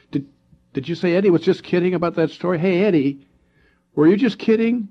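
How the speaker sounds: high, loud and fast, tumbling over the words; anxious rush. Acted. Arthur anxiously asking Eddie if he was kidding, fast and high. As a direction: blurt it out, half scared.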